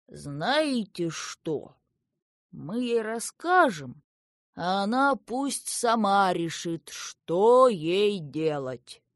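put-on slow, deliberate, drawn-out old gnome. A narrator's reading. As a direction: pronounce a verdict, weighting each phrase. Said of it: "Старый гном набил трубку".

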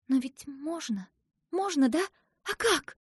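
put-on high, hopeful child questions; eager pleading. A narrator's reading. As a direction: press anxiously for an answer, pitch climbing. Asya pleads for a way.

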